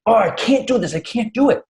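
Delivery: put-on high, whiny kid voice, frustrated; frustrated daughter. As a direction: mimic a daughter who is fed up and near giving up. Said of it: "she was very frustrated".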